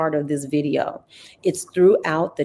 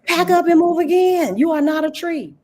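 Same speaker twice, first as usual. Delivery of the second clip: high, loud, emphatic push; rally them, pitch up and punch it; fired-up pep talk to the audience, high and loud; emphatic urging; 11 semitones higher, 4 dB louder than usual. Real, not acted. Host urging listeners emphatically.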